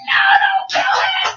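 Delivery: very high, tearful panicked cry; panicking sister. Retold or imitated. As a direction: plead in a shrieking mock panic. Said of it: "my, my sister's panicking".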